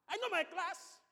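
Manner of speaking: indignant outburst. Acted. Congregant answering back to the pastor, indignant. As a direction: act out an offended churchgoer bursting out.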